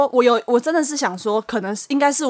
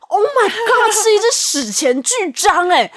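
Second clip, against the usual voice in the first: startled shock; 8 semitones higher, much slower than usual. Retold or imitated. Spotting huge cockroach on desk.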